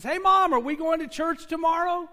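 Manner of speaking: high, loud call across the house; child yelling to mom. Retold or imitated. Little girl calling to her mom in another room, high loud yell. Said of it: "she yells to her mom".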